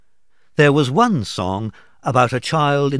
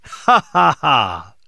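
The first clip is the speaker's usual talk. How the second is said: acted laugh; 3 semitones higher, 5 dB louder than usual. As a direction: burst into laughter, dropping at the end. Fred mimics Scrooge, then laughs.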